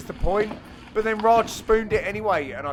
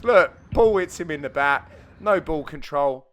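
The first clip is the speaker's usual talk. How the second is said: normal talking voice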